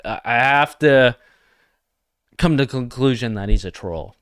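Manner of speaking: ordinary delivery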